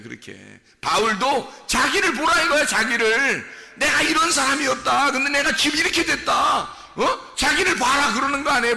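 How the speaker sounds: loud, high, exhorting pulpit voice; full-force preaching. Real, not acted. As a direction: cry out to the congregation like a preacher. (힘껏 외치는 설교)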